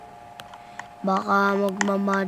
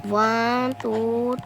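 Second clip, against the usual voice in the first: usual speaking voice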